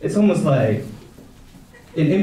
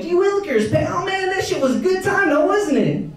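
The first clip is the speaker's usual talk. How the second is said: much higher, goofy square voice; dorky wholesome voice. Retold or imitated. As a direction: play a dweeb trying too hard to sound cool. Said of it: "I'm like, I'm trying to be cool".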